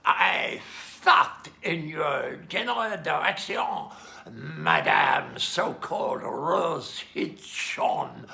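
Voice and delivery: loud, slow mock French accent, taunting; slow, booming stage French accent with a cheeky insult. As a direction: ham up a French accent and taunt her slowly and loudly. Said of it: "in my very best French accent".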